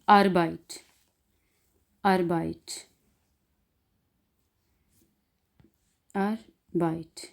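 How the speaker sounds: अरबाइट with a plain R, not ghain; R kept before B in अरबाइट; R pronounced as R. Shown as a demonstration. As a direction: model अरबाइट carefully with the R sounded. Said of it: "आर की आवाज़ निकालनी है".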